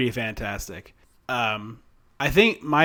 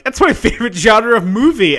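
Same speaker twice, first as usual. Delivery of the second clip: high, loud excited burst; overeager enthusiasm; 8 semitones higher, 8 dB louder than usual. Retold or imitated. Podcaster retelling reply to worried coworker, loud and overeager. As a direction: jump in pitched up, loud and eager.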